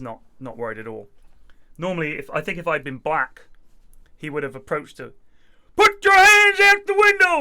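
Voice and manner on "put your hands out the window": high, very loud, drawn-out cop order; mock police shout. Imagined cop approaching a suspect, shouted command. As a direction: yell it like an aggressive police officer.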